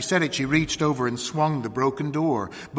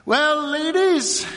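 high, loud, drawn-out greeting; jovial county attorney; 6 dB louder, slower than usual. A narrator's reading. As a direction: hail the women with condescending pleasantry. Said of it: "turning from serious things to little pleasantries".

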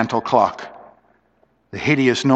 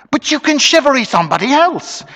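high-pitched desperate suggestion; urgent, anxious bargaining; 12 semitones higher than usual. A narrator's reading. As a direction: plead with the crowd as a last resort.

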